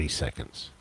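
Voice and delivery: ordinary talk, nothing marked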